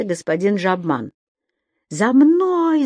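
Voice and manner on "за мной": yelling. A narrator's reading. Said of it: "заорал он".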